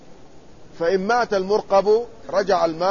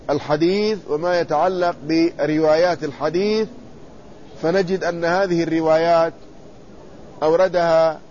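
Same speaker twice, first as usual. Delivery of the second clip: ordinary talk, nothing marked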